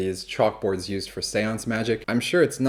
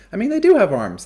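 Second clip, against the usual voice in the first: "do" leaps up in emphatic correction; stress on DO; 11 semitones higher, 7 dB louder than usual. Real, not acted. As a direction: DO pushed high, loud and long. Contradicting the claim about birds.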